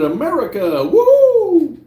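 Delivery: high, loud whoop on woo; hammed-up patriotic cheer; mock cheer. Reading a patriotic chat cheer.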